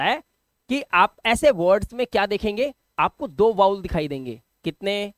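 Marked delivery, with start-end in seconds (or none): none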